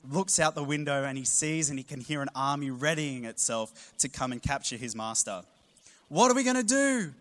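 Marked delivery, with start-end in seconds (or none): 6.1-7.1 much higher, louder frightened cry; panicked cry. Acted. Servant cries to Elisha about the army outside, panicked. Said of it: "he cries to Elisha".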